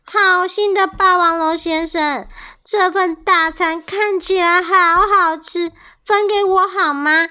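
sweet-talking to get the meal; sly villain voice. A narrator's reading. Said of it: "恶霸龙说着". (恶霸龙假装客气)

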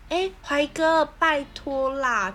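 high, slow whiny begging; cutesy pleading. Shown as a demonstration. Friends mocking their own fake cuteness, sweet drawn-out plea. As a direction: act cute and drag out the plea. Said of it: "就像我们装可爱".

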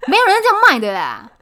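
loud, high yell, punching 样; mock furious yell. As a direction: shout it like a grumpy shopkeeper. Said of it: "反正就讲一个很夸张的年份".

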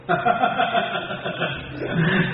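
airless chuckle trailing down; soft chuckle. Acted. Brothers laugh at the swollen-faced man, soft falling chuckle. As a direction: let a quiet laugh fall away. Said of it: "哥俩下来乐".

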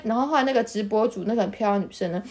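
ordinary talk, nothing marked

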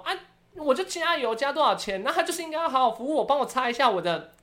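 high, mock-entitled dad voice; imitating dad. Daughter mimics her dad demanding gas station service, high and whiny.

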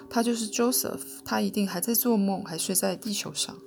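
ordinary delivery

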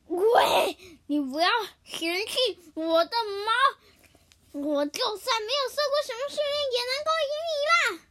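loud, high heated retort; angry. Acted. Hippo angrily defending his fur to Apan. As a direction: fire back with blazing eyes. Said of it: "河马眼睛发火地说".

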